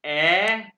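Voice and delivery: "ए" held long and loud as an open German ä; drawn-out "ए" with a falling tune, repeated; umlaut pronunciation demo. Shown as a demonstration. Teacher shows how A-umlaut sounds, long loud vowel demo. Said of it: "ए को कैसे pronounce करते हैं".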